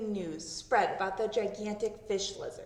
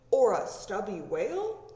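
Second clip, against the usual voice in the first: curious man; 6 dB louder than usual. Acted. Men guessing at the bones.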